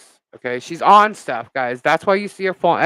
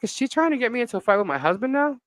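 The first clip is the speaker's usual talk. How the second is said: exasperated; 7 semitones higher, faster than usual. Retold or imitated. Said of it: "I'm like".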